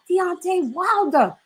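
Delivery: slow, high mock American accent. Host mimicking the interviewer in an American accent. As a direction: drag out the name in a put-on American accent. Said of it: "You're hilarious when you do your American accent".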